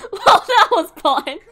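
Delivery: slow, loud words through laughter; laughing. Real, not acted. Laughing after chaos.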